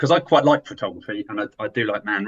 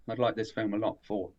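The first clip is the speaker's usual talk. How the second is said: "four" repeated much softer and lower, almost to himself; quiet repeat; 3 semitones lower, 8 dB quieter than usual. Real, not acted. Repeating his star rating for the host, in a soft low aside. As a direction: echo the rating under your breath. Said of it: "repeats his rating softer".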